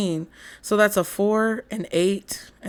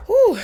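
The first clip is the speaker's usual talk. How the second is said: loud exhale; 14 semitones higher, 7 dB louder than usual. Acted. Exhaling a big breath.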